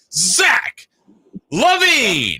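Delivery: "Zach" hit hard, "LaVine" rising high and loud; announcer punch on "Zach", big lift on "LaVine"; punchy Zach LaVine. Retold or imitated. Imitating the arena announcer for his coworker, punching "Zach". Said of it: "you just hit the Zach pretty, pretty strongly".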